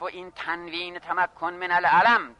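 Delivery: normal talking voice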